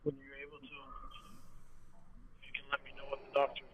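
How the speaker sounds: soft, breathy-free whisper, slowed down; whispered request. Real, not acted. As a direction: whisper it gently so as not to wake anyone. Quiet message to a sick partner.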